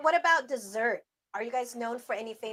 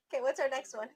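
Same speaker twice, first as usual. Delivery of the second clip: ordinary delivery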